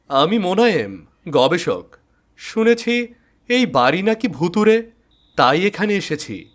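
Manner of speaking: a researcher calling out into the dark house; bold investigator. Acted. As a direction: investigator answering the unseen voice. Investigator introduces himself.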